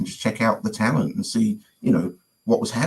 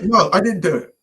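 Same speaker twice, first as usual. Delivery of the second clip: high-pitched retold protest; imitating an insistent denial; 10 semitones higher, 3 dB louder than usual. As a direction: plead innocence to a disbelieving friend. Friend denies the prank again.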